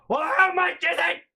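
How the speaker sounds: high shouted demand, imitating mom; mom yelling. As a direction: scream the question impatiently. Acted mom yelling question.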